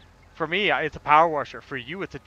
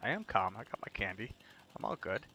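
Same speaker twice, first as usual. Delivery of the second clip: much softer and lower, faking calm; forced calm; 9 semitones lower, 13 dB quieter than usual. Acted. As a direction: settle the voice right down, softly. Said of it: "You need to calm down there".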